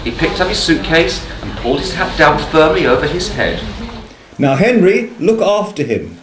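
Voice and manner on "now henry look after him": higher-pitched, mildly raised call; calling after. A narrator's reading. Wife to husband heading for the buffet, lightly calling after. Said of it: "Missus Brown called after them".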